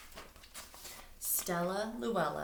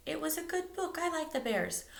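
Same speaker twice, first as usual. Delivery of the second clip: little brother; 11 semitones higher than usual. Acted. Little brother answering his dad. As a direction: squeak it out like a toddler.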